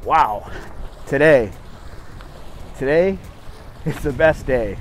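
ordinary talk

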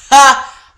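sharp loud high "Ha!" of disbelief; loud high scoffing ha; mock scoff laugh. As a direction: bark a scornful laugh.

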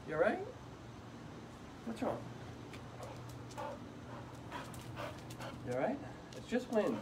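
soft, hushed reassuring murmur; calm the frightened dog gently and softly; soothing a scared dog. Real, not acted. Owner quietly calming his dog scared of the wind.

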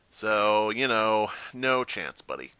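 ordinary talk

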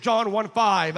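ordinary talk, nothing marked

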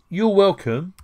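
you're welcome said high and loud, rising; bright loud modelling. Shown as a demonstration. Teacher calling out the phrase to the class, high and loud.

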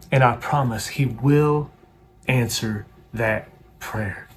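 ordinary talk

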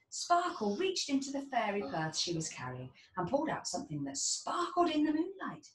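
ordinary delivery

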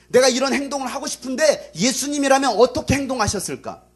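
high, loud, insistent sermon; full-force preaching. Real, not acted. Preacher urging believers to ask what Jesus would do, impassioned. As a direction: drive each question home loudly.

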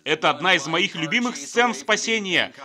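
usual speaking voice

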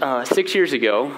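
ordinary delivery

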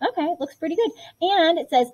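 ordinary talk

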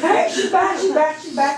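high, excited repeated cry; excited announcement. Retold or imitated. Mother to doctors as daughter revives, retold excited cry.

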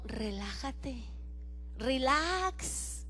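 soft, hushed divine calm; calm God voice. Retold or imitated. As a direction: soothe like God himself. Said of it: "Y Dios me dijo". (voz de Dios, suave y tranquila)

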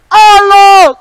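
long, high, very loud holler; calling out. Acted. Lost survival host calling for help, loud holler. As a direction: holler it far across the land.

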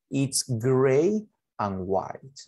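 extremely slow, stretched words; teacher modelling English very slowly; slow model sentence. Shown as a demonstration. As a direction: draw it out for beginners. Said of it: "Adjetivos de color".

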